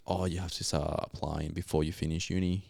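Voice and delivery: normal talking voice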